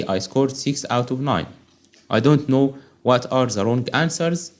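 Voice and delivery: normal talking voice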